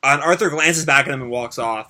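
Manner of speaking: ordinary delivery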